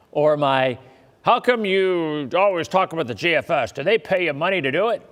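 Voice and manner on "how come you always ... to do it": lightly put-on suspicious heckler, quicker toward the end; mock heckler voice. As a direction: tease a distrustful viewer, half-joking. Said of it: "That voice, too".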